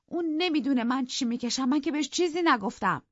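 put-on high young woman's voice, hurt; young wife, aggrieved. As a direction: plead that nobody knows her pain. Isabella voiced in the story.

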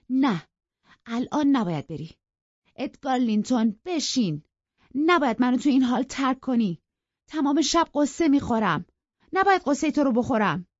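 high, loud, urgent pleading; insistent. A narrator's reading. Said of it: "با اصرار گفت".

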